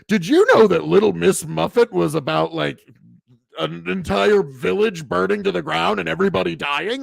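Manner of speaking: hammed-up shocking-fact reveal, loud and high; excited TikTok explainer. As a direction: parody a breathless trivia video. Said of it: "find a TikTok video about".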